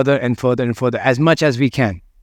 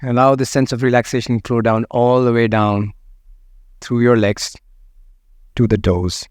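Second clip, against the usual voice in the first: guided meditation; 3 semitones lower than usual. Acted. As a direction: let each phrase settle before the next. Guides a body scan.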